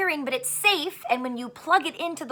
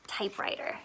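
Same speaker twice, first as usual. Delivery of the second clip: whispered word; 5 semitones lower, 11 dB quieter than usual. Shown as a demonstration.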